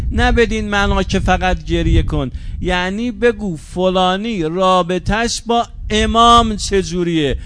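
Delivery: fervent pulpit preaching. Acted. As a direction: preach it out to the mourners with growing fervour. Preacher at full force.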